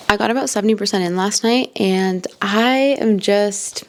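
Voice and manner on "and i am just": ordinary talk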